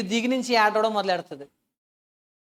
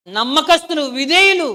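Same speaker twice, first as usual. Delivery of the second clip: louder and higher, proclaiming each word; rising proclamation; 5 semitones higher, 9 dB louder, slower than usual. Acted. Preacher to the congregation, fervent proclamation. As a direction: call out the faithful with fervour.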